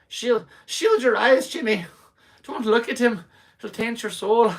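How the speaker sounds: high, loud, shrieking mock mother; alarmed Irish mom. Mom warning little Jimmy about the drunk, alarmed mock cry. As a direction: cry out as a scandalised mom impression. Moms warning child, acted in story.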